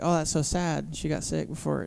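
soft, condoling imitation; softly voiced pity; hushed sympathy. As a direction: murmur mock condolences very quietly. Audience sympathy, acted softly.

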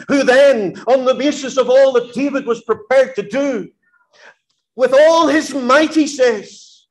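high, loud closing appeal; full-force preaching. Acted. Preacher urging the prairie youth, high and fervent. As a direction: press the call home with rising fervour.

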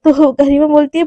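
usual speaking voice

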